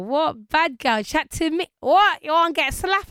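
loud, high, playful threat; hammed-up aggressive challenge; mock confrontation. Plays her tough schoolgirl self, joking.

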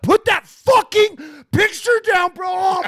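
high, loud disgusted yelling; disgusted shout. Retold or imitated. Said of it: "hear the tone in his voice change".

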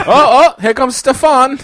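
excited announcer. Acted. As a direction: shout the arrival like a commentator. Mock race commentary.

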